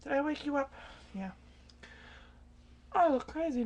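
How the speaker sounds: ordinary talk, nothing marked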